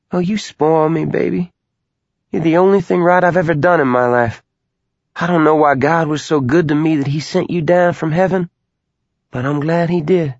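high, fond mother's voice; doting mother voice. Acted. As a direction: play the adoring mom. Mother voice in narration.

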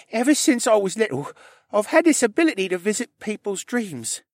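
put-on high young character voice; high-pitched, earnest tale-telling; Rylan, shy confessing stable boy. A narrator's reading.

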